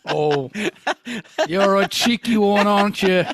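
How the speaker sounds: cheeky mock Cockney; fake British accent. Fake Biff reacts to the punchline, hammed-up British accent. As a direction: tease in a put-on London accent. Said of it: "what accent I'm going to appropriate".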